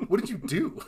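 high-pitched incredulous question through laughter; laughing disbelief. Retold or imitated. Podcaster mocking a sudden limp, laughing disbelief. Mock reaction to sudden limping.

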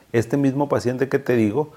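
ordinary talk

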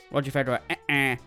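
ordinary talk

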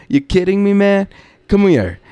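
high, pushy retort; incredulous challenge. Retold or imitated. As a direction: imitate a guy taking up a challenge. Acted cocky challenge from Jersey guy.

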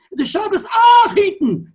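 high, loud, tearful shout, drawn out slowly; Minchas Elazar's crying plea. Retold or imitated. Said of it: "That's the way he said it".

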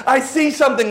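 hammed-up king crying release them; king orders the men freed, urgently; urgent king's command. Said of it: "And then he goes".

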